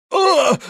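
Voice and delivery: very high startled wail; sustained high "oh" while tumbling; falling yell. A narrator's reading. Prince trips over a threshold and wails in alarm. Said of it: "с воплем".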